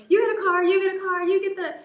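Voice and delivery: booming generous host announcing prizes; Oprah. Retold or imitated. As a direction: hand out notes like Oprah giving away cars. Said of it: "like Oprah".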